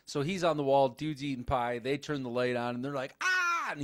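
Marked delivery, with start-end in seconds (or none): 3.2-3.7 short high-pitched yelp; mock startled scream. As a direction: imitate a shocked shriek, high. Imitates characters' startled scream.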